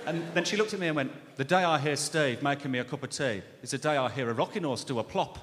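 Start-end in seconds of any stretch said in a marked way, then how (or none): 1.4-5.4 a hint of a tired Birmingham clerk; Brummie accent. Comedian imitating sweaty Sharon, light Brummie accent. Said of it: "she had a thick Brummie accent".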